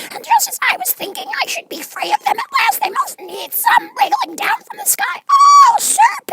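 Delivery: very high, loud bird shriek building up; high loud pigeon shriek. A narrator's reading. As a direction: pigeon rises into a scream. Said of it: "raising its voice to a shriek".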